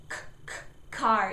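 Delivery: two separate K puffs, then "car"; isolated K sound. Shown as a demonstration. Parent drilling the child, K sound then "car". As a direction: break off the K twice before "car". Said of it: "say it like this".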